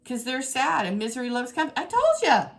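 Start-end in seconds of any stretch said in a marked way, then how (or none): 1.8-2.5 high, loud gleeful I-told-you-so; triumphant told-you-so. Real, not acted. To viewers after the cards prove her right, high triumphant crowing. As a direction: crow it at the viewers, much higher.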